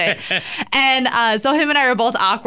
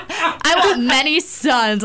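higher, slow, hammed-up demand; mock imitation of fiance; 4 semitones higher, slower than usual. Guest imitating fiance wanting sons, mock-grand declaration.

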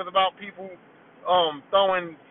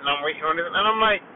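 ordinary talk

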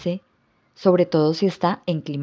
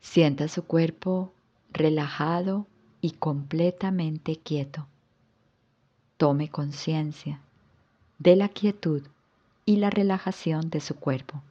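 calm yoga nidra guidance with long pauses; guided relaxation. Acted. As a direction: guide the listener into rest, phrase by unhurried phrase. (relajación guiada, con calma y pausas largas)

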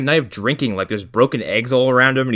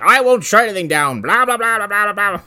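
high, dismissive blah-blah; pompous mayor; 10 semitones higher than usual. Retold or imitated. The mayor waving off the danger, high and dismissive. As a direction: brush it all off like a smug mayor. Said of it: "he's like".

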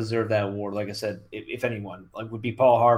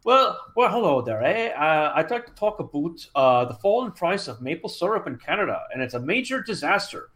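higher, chirpy Canadian host voice, eh; mock Canadian accent, higher; Canadian radio host accent; 5 semitones higher than usual. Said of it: "the Canadian talk radio stations is just like".

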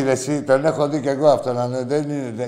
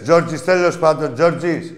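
insistent calling out; 5 semitones higher, 8 dB louder, slower than usual. Real, not acted. Host talks over unclear rude caller.

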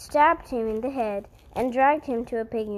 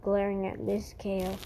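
plain reading voice

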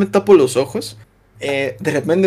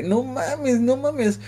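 softer, stunned, muttered under breath; inner shocked thought; 5 semitones higher, 7 dB quieter, much slower than usual. Retold or imitated. Recalling the moment he understood, he voices his stunned thought softly. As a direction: think it to yourself in quiet disbelief. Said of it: "Fue como de".

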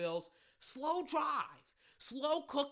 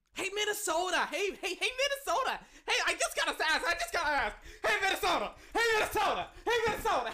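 high taunting call, laughter in it; gleeful taunt; 7 semitones higher, faster than usual. Real, not acted. Heckling Minnesota about the playoffs, laughing high taunt. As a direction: needle them with repeated high hollers. Mockingly calling out a rival team.

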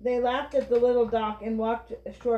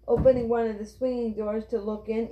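usual speaking voice

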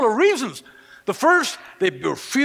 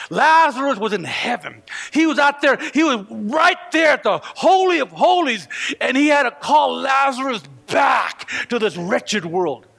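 louder, rising preacher's cry; fervent preaching; 3 semitones higher, 3 dB louder than usual. Acted. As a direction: preach it hard to the congregation. Preaching at full force.

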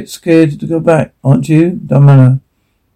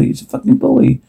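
overjoyed disbelief; 10 semitones higher, 5 dB quieter than usual. Real, not acted. Expectant father hearing it's a boy, overjoyed. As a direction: pitch jumps way up on the repeat. Learning he'll have a son.

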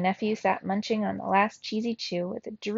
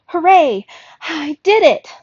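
high, loud shout of victory; cry of triumph; 8 semitones higher, 11 dB louder, slower than usual. A narrator's reading. Thea bursts in shouting that she cracked the map, loud and triumphant. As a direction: yell it out, bursting with success. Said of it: "she cried".

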